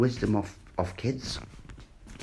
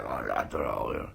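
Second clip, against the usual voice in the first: imitating a mumbled dodge; 12 semitones lower, 6 dB quieter than usual. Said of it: "But, like".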